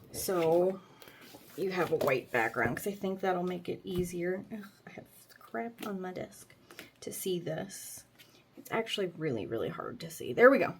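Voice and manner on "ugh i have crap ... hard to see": muttered whisper to herself. Real, not acted. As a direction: whisper it to yourself. Whispered aside.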